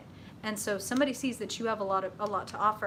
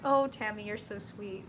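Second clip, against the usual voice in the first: usual speaking voice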